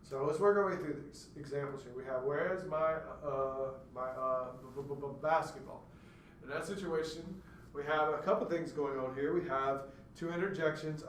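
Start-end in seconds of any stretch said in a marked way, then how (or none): 2.2-5.8 demonstrated sound-repetition stutter; stuttering demo. Shown as a demonstration. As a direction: stutter on the "b-" of basketball. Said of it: "we do have basketball being stuttered on".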